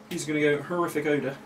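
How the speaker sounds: ordinary delivery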